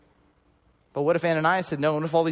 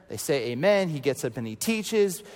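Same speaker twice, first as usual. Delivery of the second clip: ordinary talk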